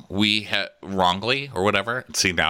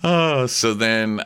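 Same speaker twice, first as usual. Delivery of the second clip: normal talking voice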